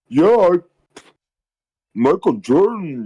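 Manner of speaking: high, loud, dopey bro voice; mock clueless dude. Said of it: "the guy would be like this".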